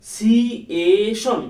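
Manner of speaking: syllable by syllable. Shown as a demonstration. Teacher helping someone who struggles with pronunciation, syllable by syllable. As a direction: stretch each syllable of "সিএ শন" firmly. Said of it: "আপনার উচ্চারণ খুব সমস্যা".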